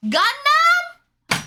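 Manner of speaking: high, loud excited cheer; excited shout. Real, not acted. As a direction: shout the name with joy.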